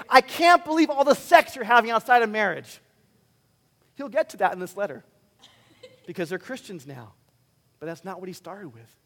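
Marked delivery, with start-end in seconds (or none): 0.1-2.8 loud, higher mock outrage; mock scolding. Preacher imagining Paul berating Corinth, mock scolding. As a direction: scold them at full volume, hammed-up.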